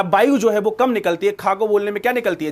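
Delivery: normal talking voice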